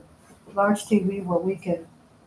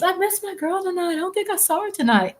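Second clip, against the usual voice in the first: high voice; 11 semitones higher than usual. Retold or imitated. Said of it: "Like Lola Hot Sauce".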